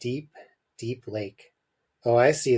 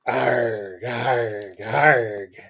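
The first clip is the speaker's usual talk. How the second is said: three drawn-out wordless "arg" barks; barked grunts; much slower than usual. Acted. Richard barks at his parents' talk, wordless drawn-out arg. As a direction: stretch each cry out like an odd bark. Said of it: "barked Richard".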